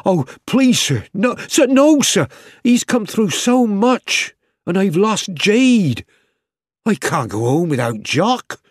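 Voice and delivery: put-on young soldier pleading with the vet not to put Jock down; desperate pleading. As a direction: beg as if the dog's life hangs on it. Boy begging vet to spare dog.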